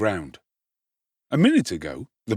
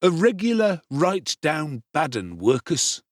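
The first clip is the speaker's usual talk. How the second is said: cool, unhurried repeat of the insult; coolly; 6 semitones higher than usual. A narrator's reading. Noah repeats the insult to Oliver coolly. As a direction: say it again, calm and cold. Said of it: "replied Noah coolly".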